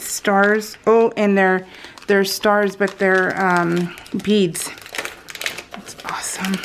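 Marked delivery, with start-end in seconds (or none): 5.7-6.5 soft breathy whisper of delight. Real, not acted. Admiring the beads for viewers, whispered. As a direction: breathe it out in awe.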